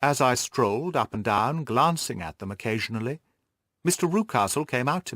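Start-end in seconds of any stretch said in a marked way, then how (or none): none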